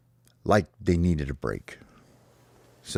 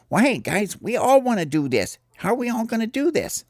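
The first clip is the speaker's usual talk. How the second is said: much higher, puzzled bird chirp. Acted. The birds ask each other how to share the ball, high chirp.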